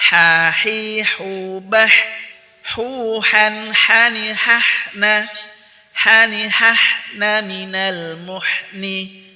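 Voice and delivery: loud measured recitation. Shown as a demonstration. Teacher reciting practice text for students, loud recitation. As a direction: recite in full slow voice.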